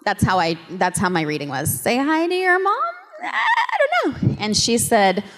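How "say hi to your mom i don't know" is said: baffled. Retold or imitated. Baffled by her own reading.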